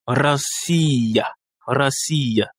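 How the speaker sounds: racia with a rolled R, stress on the I. Shown as a demonstration. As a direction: racia said with the R trilled as in Russian. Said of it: "Il faut s'arranger à bien rouler les R".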